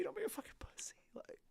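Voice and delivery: high, soft whispered jab. Acted. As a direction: hiss it as a secret taunt. Urging donor, whispered aside.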